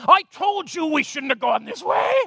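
whiny told-you-so; told-you-so complaint. Retold or imitated. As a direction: snipe at the wheel in traffic. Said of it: "and you say".

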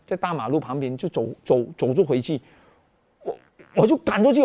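normal talking voice

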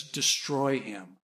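low, slow, hushed command; lightly voiced divine order, soft and grave; voice of God. God commanding the mother from above, low quiet command. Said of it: "it's the voice of God".

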